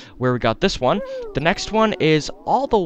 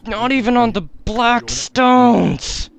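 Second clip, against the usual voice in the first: frustrated; 8 semitones higher, 4 dB louder, slower than usual. Real, not acted. Gamer ranting after a failed puzzle.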